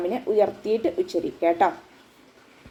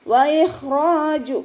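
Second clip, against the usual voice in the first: slow, higher, louder chanted ഇഖ്റാജു; correct recitation; 7 semitones higher, 4 dB louder, much slower than usual. Shown as a demonstration. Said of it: "എന്ന് ഓതരുത്".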